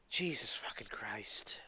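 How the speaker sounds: slow, exasperated whisper; slow whispered curse of disbelief. Real, not acted. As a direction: breathe it out slowly in disbelief. Exasperated rant, curse again.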